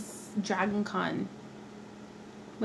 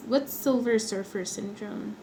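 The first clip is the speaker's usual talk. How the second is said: ordinary delivery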